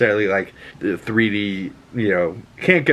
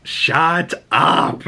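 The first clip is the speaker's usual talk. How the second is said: imitating angry; 5 semitones higher than usual. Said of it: "he's so angry about it".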